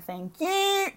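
high, sassy thank you; dismissive singsong thanks; sarcastic thank you. Real, not acted. Sarcastic sign-off to critics.

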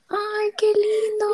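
high, slow sugary thank-you; mock sweet reply. Imitating her younger self thanking the boy, high and slow. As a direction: put on a high polite delight. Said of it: "yo dice como".

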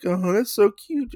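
put-on high patronising baby-talk tone; condescending coo. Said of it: "he's like".

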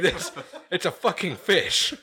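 amused rant broken by laughter; half-laughing scoff at easy fishing. Real, not acted. Laughing while ranting about fishing.

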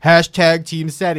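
loud, slow rallying chant; imitated fan cheer. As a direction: mimic grown men cheering for their team. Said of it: "middle-aged dudes standing up being like".

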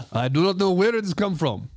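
stilted mock foreign accent, part French, part Russian; muddled French-Russian accent. Baffled by own new accent, hammed-up French-Russian. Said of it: "Sounds kinda Russian".

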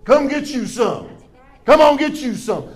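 lightly voiced God calling the thirsty to come drink; God inviting. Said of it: "God says".

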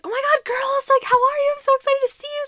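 high-pitched gushing hello, voice way up; excited greeting. Retold or imitated. Ultrasound tech greets returning parents, mimicked excited gushing. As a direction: squeal it like greeting favourite clients.

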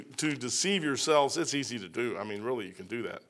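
ordinary delivery

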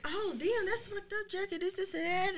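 high, hammed-up fake concern; mocking fake sympathy. Retelling a two-faced friend's fake concern, in a high mocking voice. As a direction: mimic a two-faced friend pretending to care. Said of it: "She like".

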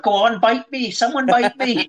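high-pitched jokey yell; a fan on the floor, hammed-up high yell; mock shout. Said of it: "lie on the floor and just shout".

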